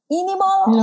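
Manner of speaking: squeaky high mock resignation; high silly voice. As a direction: pitch it way up, playful.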